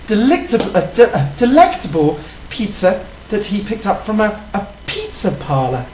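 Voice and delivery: put-on lightly done English lady accent; mild British accent. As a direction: play a prim British guest rambling. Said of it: "you're really boring right now".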